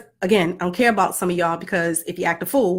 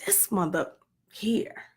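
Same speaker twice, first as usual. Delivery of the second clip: muttered whisper; 9 dB quieter, slower than usual. Retold or imitated. Mom venting about her kid in a secret whisper. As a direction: whisper "This mother here" under your breath, slow. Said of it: "I say things like".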